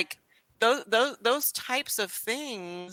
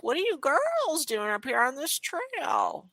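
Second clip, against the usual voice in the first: a higher, slow mock biker voice, drawn out; imitating the biker; 7 semitones higher, slower than usual. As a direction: play the main dirt biker, sizing up two girls. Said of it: "the main guy was like".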